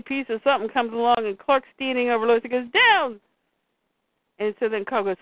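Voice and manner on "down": sharp retold shout of warning; a storyteller's quick shout. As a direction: yell it like ducking debris.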